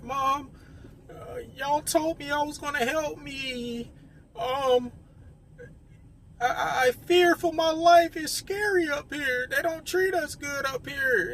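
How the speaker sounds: put-on high, pleading voice of a scared girl; girl in fear. Scared girl on the phone pleading with her mom, high. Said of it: "you know, she was in fear".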